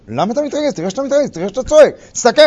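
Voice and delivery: needling taunt. Retold or imitated. Spouse baiting partner to lose his temper, loud taunting. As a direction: provoke him with feigned innocence.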